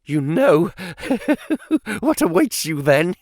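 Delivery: high, tittering nervous villain; nervous giggle. A narrator's reading. Villain taunting the captive through the interpreter, giggling nervously. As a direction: giggle nervously between the questions. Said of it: "giggled in his nervous way".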